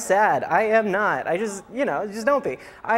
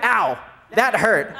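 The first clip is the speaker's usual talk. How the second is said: mock hurt husband; slower than usual. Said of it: "and he went".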